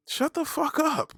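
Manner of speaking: slow, very high-pitched shut-down; exasperated. Acted. Host silencing skinny critics, high drawn-out exasperation. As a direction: stretch it out high without shouting.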